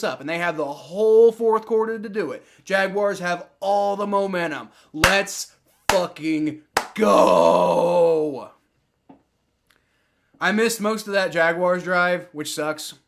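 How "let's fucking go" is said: drawn-out roaring cheer; celebratory yell. Real, not acted. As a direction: stretch each word into a hype yell. Celebrating team's momentum.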